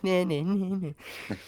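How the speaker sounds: singsong nah nah; childish taunt. Retold or imitated. Mocking a rival, childish singsong. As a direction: taunt like a schoolkid.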